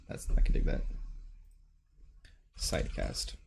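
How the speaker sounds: soft musing whisper. Real, not acted. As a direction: whisper it to yourself.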